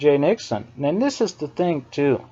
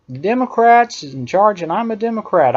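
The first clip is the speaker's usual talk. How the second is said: ordinary talk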